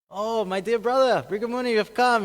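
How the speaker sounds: high, loud delighted welcome; effusive greeting. Acted. Shiva warmly welcomes his brother.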